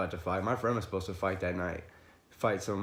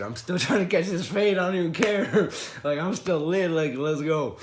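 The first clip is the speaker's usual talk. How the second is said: high, loud eagerness with laughter; amped, laughing retold battle cry; hyped up; 7 semitones higher, 5 dB louder than usual. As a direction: egg the fight on, laughing. Hyped retelling, laughing as he goes.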